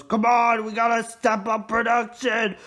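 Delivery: loud, high mock boss shout; play-acted yelling at the workers; boss yelling through megaphone. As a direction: bark orders as a hammed-up boss on a megaphone. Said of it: "he yells in his microphone, or, or his megaphone".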